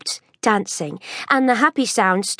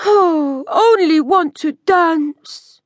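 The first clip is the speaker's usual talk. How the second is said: sad mumble; 3 semitones higher, slower than usual. A narrator's reading. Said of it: "he mumbled".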